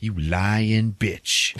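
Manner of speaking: slow, drawn-out retold insult; mock contempt. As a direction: stretch each word out in mock disgust. Acted aside while call is held.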